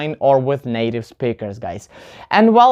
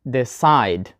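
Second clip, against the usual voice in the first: deCIDE, short weak de- and strong CIDE; "Decide" with the beat on -cide; stress on second syllable; 6 semitones lower than usual. Shown as a demonstration. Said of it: "we put the stress on the second syllable".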